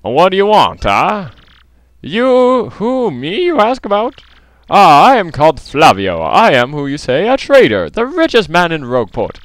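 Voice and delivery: high, grandiose foreign-sounding trader; pompous rich trader, theatrical. Acted. Rich trader lamenting his empty life, melodramatic. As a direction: declaim it with melodramatic swoops.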